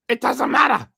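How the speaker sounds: strained voice, cracking high; losing voice. Real, not acted. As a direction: talk with a voice that keeps giving out. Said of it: "I'm losing my voice".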